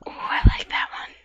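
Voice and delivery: whispered delight. Acted. Searching seashells, delighted whisper to herself.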